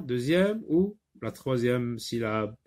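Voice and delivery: ordinary talk, nothing marked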